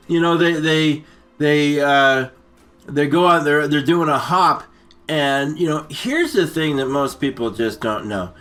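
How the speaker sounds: ordinary talk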